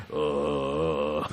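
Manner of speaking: deep, quiet drawn-out "uh"; super-low imitated groan. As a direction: drop to a comically low held note. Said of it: "He's, like, super low".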